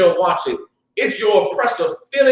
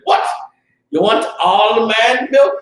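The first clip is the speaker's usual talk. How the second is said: Jamaican uncle; 3 semitones higher than usual. Retold or imitated. Jamaican uncle balking at his nephew's grocery request, incredulous Jamaican accent. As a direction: stretch it out in an appalled Jamaican accent. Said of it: "the Jamaican dude said".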